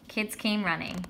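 plain reading voice

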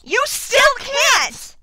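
shout. Acted. Shouting at him.

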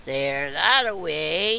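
loud, slow wolf growl; menacing storybook wolf, drawn out. A narrator's reading. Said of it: "and growled".

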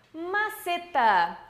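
"Maceta" bem devagar; slow model word. Shown as a demonstration. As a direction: alongue "Maceta" para repetir. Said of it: "Como se dice eso en español?". ("Maceta" lento e claro)

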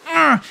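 high effortful roar of victory; real triumphant yell; triumphant grunt. Real, not acted. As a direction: let out a winning growl. Winning the shootout.

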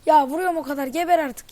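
loud raging yell; furious shout. Real, not acted. Gamer screaming at an enemy who won't die, furious.

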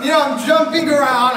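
loud, laughing celebration; excited. Real, not acted. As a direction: bubble over with joy. Celebrating the win.

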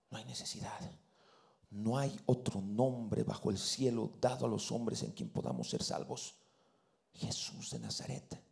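low and soft, dropping to a whisper; solemn hush. Acted. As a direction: let the name land in a whisper. Clímax solemne sobre el nombre. (susurro solemne)

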